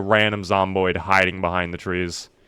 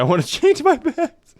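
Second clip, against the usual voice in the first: laughing. Real, not acted. Jokes about his viewers' bets.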